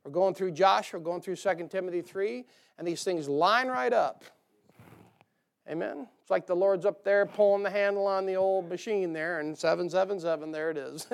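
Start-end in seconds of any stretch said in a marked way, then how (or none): none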